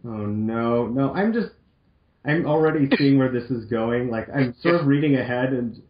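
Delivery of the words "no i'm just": ordinary talk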